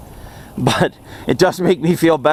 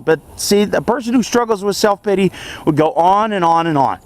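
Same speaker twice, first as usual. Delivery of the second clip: ordinary delivery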